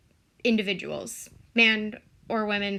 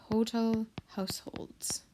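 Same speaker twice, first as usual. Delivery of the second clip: whisper; 7 dB quieter than usual. Real, not acted. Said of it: "whispered while writing".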